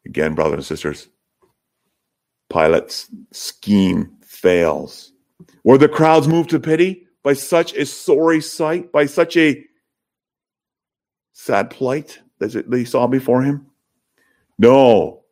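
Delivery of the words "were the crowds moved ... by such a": ordinary delivery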